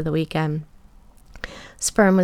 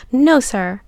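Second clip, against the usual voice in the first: higher, louder voice for a captive woman; lightly acted, higher obedient reply; submissive captive; 6 semitones higher, 7 dB louder than usual. A narrator's reading. Captive answers her captor, higher submissive voice.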